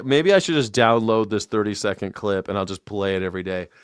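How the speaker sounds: ordinary talk, nothing marked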